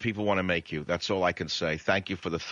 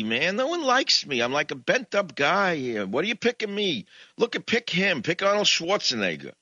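whiny Moses; 9 semitones higher than usual. Acted. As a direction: moan every excuse like a nebbish. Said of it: "That was my Moses bit".

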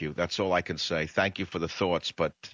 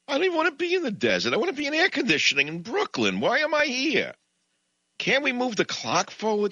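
whiny Moses; 7 semitones higher than usual. Acted. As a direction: moan every excuse like a nebbish. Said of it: "That was my Moses bit".

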